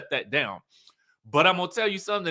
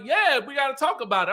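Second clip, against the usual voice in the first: higher-pitched chiming-in; mock eager agreement; 8 semitones higher than usual. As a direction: mimic someone jumping on board. Imitating Letitia eagerly agreeing.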